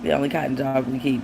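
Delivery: ordinary talk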